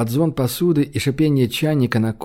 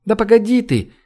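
high-pitched but restrained narrated cry; calling out; 8 semitones higher than usual. A narrator's reading. Ирина calling to Зойка to wait, high mild shout. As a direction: call after someone, pitch jumping up. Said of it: "крикнула Ирина".

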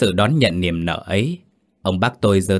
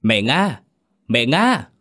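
drawn name call, rising higher on the repeat; a hailing call, not a full shout; 5 semitones higher, slower than usual. A narrator's reading. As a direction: call to someone at a distance. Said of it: "Ông gọi vọng".

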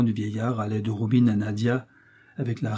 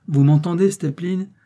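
irritated; 7 semitones higher, 6 dB louder than usual. Acted. Irritated prince scolding servant.

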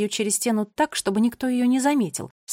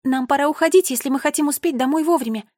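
raised, urgent voice; higher and louder with mild alarm; anxious urging; 5 semitones higher, 5 dB louder than usual. A narrator's reading. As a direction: press them to leave, worried. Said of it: "с тревогой пояснила она".

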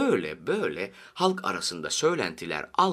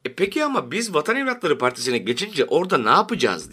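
high, louder, questioning voice; puzzled question; 7 semitones higher, 5 dB louder than usual. A narrator's reading. Party member asks what they will do there, puzzled. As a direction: ask it out to the meeting, pitched up.

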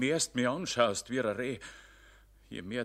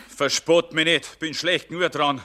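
agitated protest; 7 semitones higher, 6 dB louder than usual. Acted. Jedermann protests in distress.

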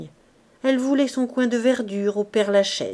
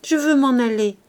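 "Je veux m'en aller" cried louder and higher, stress on "Je"; crying out; 4 semitones higher, 7 dB louder than usual. Acted. Gervaise cries to Bazouge, loud insistent demand. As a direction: shout "Je" hard, fall at the end. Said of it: "criait toujours Gervaise".

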